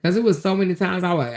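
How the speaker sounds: ordinary talk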